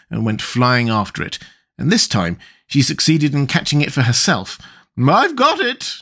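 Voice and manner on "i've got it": high, triumphant little cry. A narrator's reading. Queen catching her runaway shawl, triumphant. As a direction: exclaim in triumph, pitched right up. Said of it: "cried in a triumphant tone".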